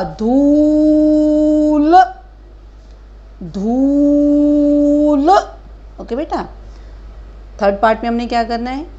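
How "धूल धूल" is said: धूल slow, higher, ऊ held long. Shown as a demonstration. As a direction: model धूल slowly. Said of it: "धू, धा, धा".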